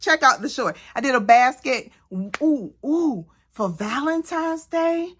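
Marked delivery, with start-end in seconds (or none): 2.1-5.1 slow, high cooing "ooh"; delighted ooh. Real, not acted. Showing viewers her Valentine's basket, a slow delighted coo. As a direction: coo over it like a treat.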